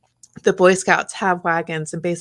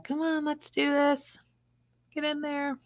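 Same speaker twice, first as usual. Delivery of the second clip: higher, softer cajoling of a tomato plant; coaxing a plant; 9 semitones higher, 5 dB quieter than usual. Retold or imitated. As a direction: sweet-talk the plant into place. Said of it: "you're talking to your plants".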